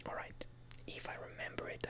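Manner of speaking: normal talking voice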